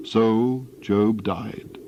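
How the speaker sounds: slow, fading final words; solemn closing. A narrator's reading. Narrator closing Job's story, slow and fading.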